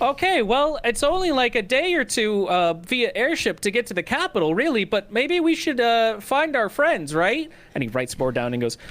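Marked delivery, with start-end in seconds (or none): 0.0-7.5 fake casual chatter. Creature faking small talk for listeners, hammy and high. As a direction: perform a breezy decoy conversation for eavesdroppers. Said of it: "his jaw opens up and he goes".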